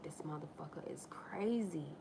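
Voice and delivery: unvoiced aside, thinking it; inner-voice whisper. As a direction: whisper it as a private thought. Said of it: "in my head, I'm like".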